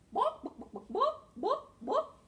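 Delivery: high, staccato clucking; chicken cluck imitation. Shown as a demonstration. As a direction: cluck like a hen in short bursts. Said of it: "A chicken says".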